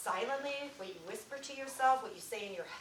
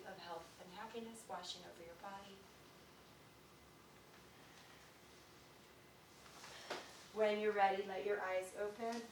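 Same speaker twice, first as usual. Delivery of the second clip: guided meditation; 9 dB quieter than usual. Acted. Teacher guiding the class through a breathing moment. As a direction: ease them gently into calm.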